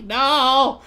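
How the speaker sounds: mock outrage. Defending queen Sayori in mock outrage. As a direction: scream like a wounded fan.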